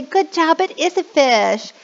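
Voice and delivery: lightly hammed-up praise; praising teacher. Shown as a demonstration. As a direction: reward the kid warmly. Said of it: "And then I say".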